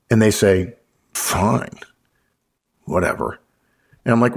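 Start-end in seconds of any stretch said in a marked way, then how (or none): 1.1-3.4 low, dragged-out sulky agreement; grudging assent. Acted. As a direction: give in reluctantly, with long pauses. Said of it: "if I get a hint of a yes, but".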